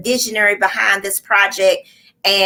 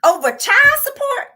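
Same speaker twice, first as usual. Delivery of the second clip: incredulous; 12 semitones higher than usual. Retold or imitated. Retold disbelief at child support case.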